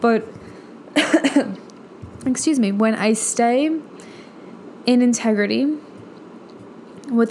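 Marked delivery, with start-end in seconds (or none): 0.9-1.5 choking cough. Real, not acted. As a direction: cough while choking on food. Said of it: "sorry, I'm choking".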